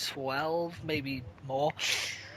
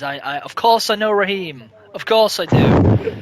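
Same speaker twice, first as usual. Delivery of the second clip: sarcastic retort; 3 semitones higher, 13 dB louder than usual. Retold or imitated. Mocking the customer who asked who Raheem was, loud sarcasm. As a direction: lay on the sarcasm.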